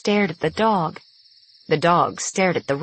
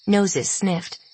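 usual speaking voice

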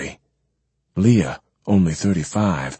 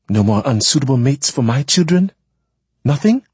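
bitter questions climbing high and louder; 8 semitones higher, 5 dB louder than usual. A narrator's reading. Woman questioning the man about her children's mates, bitter. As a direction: push the pitch up with bitter incredulity. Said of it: "she said bitterly".

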